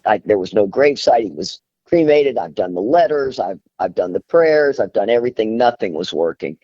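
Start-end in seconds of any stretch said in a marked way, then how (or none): none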